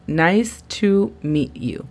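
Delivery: "Nice to meet you" slowly, word by word; slow model phrase. Shown as a demonstration. Teacher models a greeting for learners, slow and separated.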